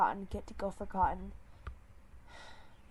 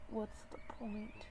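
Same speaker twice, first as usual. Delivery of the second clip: much softer, slow hopeless sigh; despairing murmur; 9 dB quieter than usual. Acted. Grieving leader giving up on the box, soft. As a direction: let it drain out of him.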